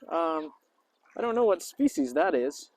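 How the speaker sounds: ordinary talk, nothing marked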